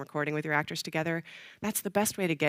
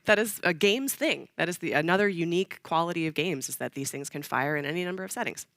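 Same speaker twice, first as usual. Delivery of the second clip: usual speaking voice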